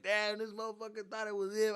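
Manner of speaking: high, jeering imitation; mocking classmates. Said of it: "everybody's making fun of me".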